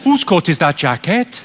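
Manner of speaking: puzzled Welsh lilt; confused Welsh accent. Shown as a demonstration. Comic demonstrates the secret of Welsh, confused Welsh accent. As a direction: sound baffled in a broad Welsh accent. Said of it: "you've just gotta sound confused".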